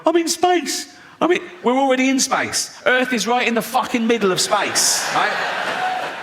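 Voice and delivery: mock amazed space tourist. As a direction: squeal like a thrilled rich tourist in orbit. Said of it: "you float around going".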